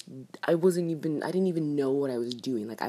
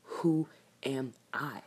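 slow, hushed whisper to herself; much slower than usual. Real, not acted. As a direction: breathe the question out slowly. Asks herself who she is.

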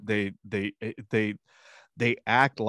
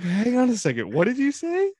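high, loud disbelief; acted stunned double-take; incredulous dad; 5 dB louder than usual. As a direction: demand a repeat, pitch shooting up. Dad's startled reaction, retold.

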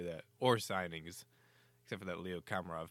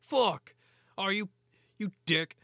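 much higher, louder player shout; mock hot-mic yell; 10 semitones higher, 9 dB louder, slower than usual. Player yelling at the ref on a hot mic, mock yell. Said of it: "It's like, this is".

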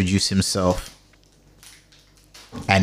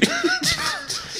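laughing; 11 dB quieter than usual. Real, not acted. Laughs at friend.

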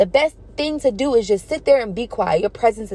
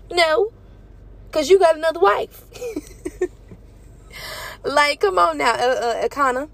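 a higher, pointed no, cutting him off; sassy comeback; 6 semitones higher than usual. Pretend. Hannah answering her husband with sass. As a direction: throw the other wife back at him.

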